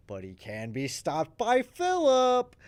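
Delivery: big, loud announcer-like build on the name; dramatic reveal. Real, not acted. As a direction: draw it out slowly, climbing high. Dramatic reveal of new character.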